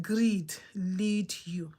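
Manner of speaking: slow, breathless whispered warning. Real, not acted. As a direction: whisper it slowly like a secret.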